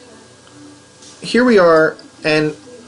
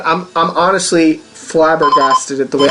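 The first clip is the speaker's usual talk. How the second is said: ordinary talk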